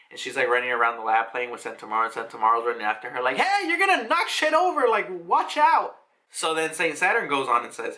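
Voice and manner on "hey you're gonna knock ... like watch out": high, urgent mock shout; alarmed warning. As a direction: call after a running kid, pitch shooting up. Calling after a child running around.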